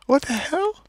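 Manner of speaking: high, slow, laughing "what the hell"; laughing disbelief. Real, not acted. Still watching the clip, slow high laughing disbelief.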